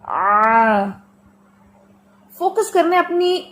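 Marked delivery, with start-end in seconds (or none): 0.0-0.8 آ strained from mid-throat; guttural ain on آ; throat ain sound. Shown as a demonstration. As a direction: push آ out like digging from the throat. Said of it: "corner sound ہوگی آ".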